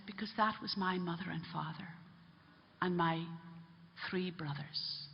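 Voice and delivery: low, soft, halting admission; grave, quiet revelation. Retold or imitated. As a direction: reveal it gently, with heavy pauses. Priest reveals victims were family.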